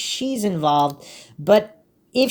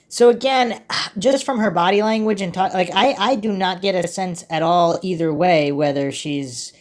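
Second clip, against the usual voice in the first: ordinary talk, nothing marked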